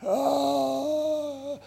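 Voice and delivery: high anguished wail of agony; retold wailing cry. Preacher imitating the attacker wailing in agony, high wail. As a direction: let out a mock agonized wail. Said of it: "he was wailing like he was in agony".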